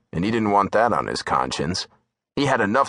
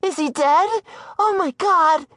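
high frantic woman's voice, a touch of sobbing; 3 dB louder than usual. Acted. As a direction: plead in panic over her lover. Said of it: "sobbed furiously".

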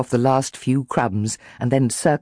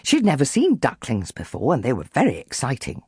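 ordinary delivery

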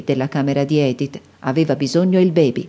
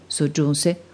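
usual speaking voice

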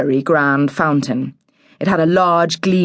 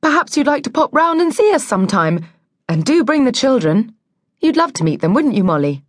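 higher, eager-to-please invitation; weakly smiling politeness; 8 semitones higher, faster than usual. A narrator's reading. Mum inviting the neighbour round, brightly polite. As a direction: invite politely through a weak smile. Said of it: "She smiled weakly".

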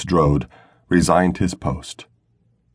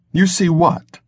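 higher woman's voice, cutting in; sharp interruption; 11 semitones higher, slower than usual. A narrator's reading. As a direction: snap back at him, pitch up high. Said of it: "she interrupted".

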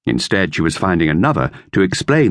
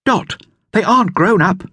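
higher, lightly voiced boy; boy's voice, lifted higher; Dick; 14 semitones higher than usual. A narrator's reading. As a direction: read it as young Dick. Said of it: "he said".

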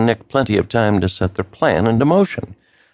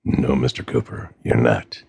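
low, gravelly threatening voice; a cold kidnapper laying down terms; gravelly phone villain, low and menacing; 10 semitones lower, 4 dB quieter than usual. A narrator's reading.

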